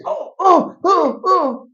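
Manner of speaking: hammed-up high zombie moan; dazed moaning. Brother burned, zombied out.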